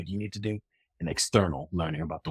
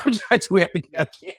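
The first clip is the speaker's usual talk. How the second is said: normal talking voice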